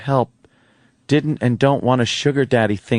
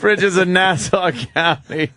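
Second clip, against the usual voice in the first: mock dramatic title; 7 semitones higher, slower than usual. Repeating the line, higher.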